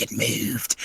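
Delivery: unvoiced whisper of a spooked [name]; hushed. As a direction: breathe it out as a whisper. [name] answers friends' question.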